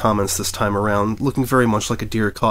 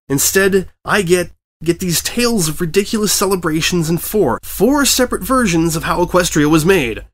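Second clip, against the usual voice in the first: ranting; 8 semitones higher, 4 dB louder than usual. A narrator's reading. Editor ranting at reporter.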